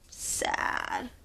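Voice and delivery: soft whispered answer. Shown as a demonstration. Answering the children about the bear, whispered. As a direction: breathe the word gently. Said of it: "What's he feeling now?".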